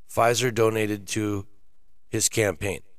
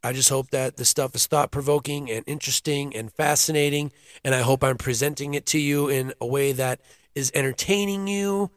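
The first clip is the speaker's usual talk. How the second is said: ordinary talk, nothing marked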